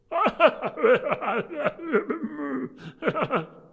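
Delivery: loud, high hammed-up laughter; lusty roaring laugh. Nephew and assembled friends laughing together, lusty high roar. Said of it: "And they roared out lustily".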